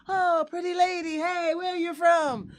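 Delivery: put-on high, loud, slow Italian-accent catcall; sing-song Italian-accent come-on. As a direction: play a cheesy Italian flirt calling out. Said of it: "talk to me with this accent".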